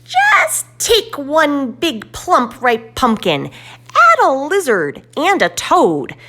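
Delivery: high, loud, slow witch voice; slow gleeful witch recipe; cackling witch. Acted. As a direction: relish the secret recipe like a cackling hag. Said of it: "she'll wink and snort and cackle".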